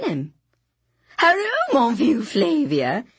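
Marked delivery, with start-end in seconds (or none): 1.2-3.0 much higher, bright hail that drops into slow French; cheery shout. A narrator's reading. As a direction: call out gleefully across the square. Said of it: "he shouted".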